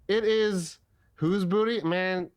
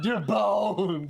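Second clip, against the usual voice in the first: mocking dumb voice; 2 semitones higher than usual. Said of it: "And you're like".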